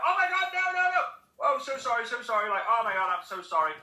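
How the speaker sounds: high, flustered apology; embarrassed dad. Retold or imitated. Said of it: "he's like".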